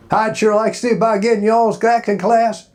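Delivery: a young man from Alabama, lightly played; Alabama drawl. Asking about acting class in his Alabama accent. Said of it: "I had that accent from, ... from Alabama".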